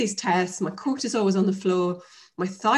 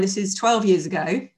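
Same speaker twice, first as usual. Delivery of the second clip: ordinary talk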